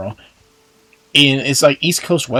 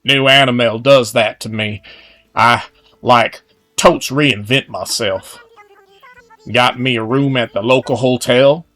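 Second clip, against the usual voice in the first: put-on Western accent; 5 dB louder than usual. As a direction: read it as a cowpoke with a Western twang. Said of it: "let me try that again".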